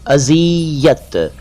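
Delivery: अजियत drawn out slowly with a z; slow correct pronunciation. Shown as a demonstration. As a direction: stretch अजियत out, long zee in the middle. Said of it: "ज sound करें". (अजियत धीरे-धीरे, ज़ी को खींचकर)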